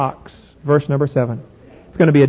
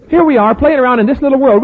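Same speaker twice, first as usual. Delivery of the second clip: usual speaking voice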